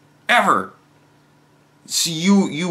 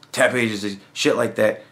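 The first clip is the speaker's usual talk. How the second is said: ordinary talk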